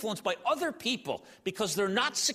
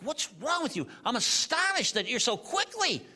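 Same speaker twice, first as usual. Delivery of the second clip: higher, indignant; exasperated Paul; 8 semitones higher than usual. Retold or imitated. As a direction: scold them like a betrayed teacher. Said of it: "Paul's like".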